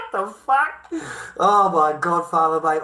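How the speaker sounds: incredulous laugh; laughing disbelief. Real, not acted. Laughing disbelief at naive man.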